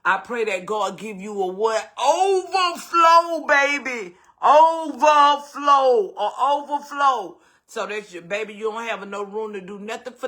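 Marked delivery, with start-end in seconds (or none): 2.0-7.4 slow, loud, high drawn-out overflow; preaching overflow. Real, not acted. Host blesses viewers, preaching overflow slow and loud. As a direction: preach it like a Sunday climax.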